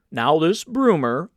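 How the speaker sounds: a touch of a pompous senator holding court; stately uncle. A narrator's reading. Uncle announcing to the ship's officers, stately and slow.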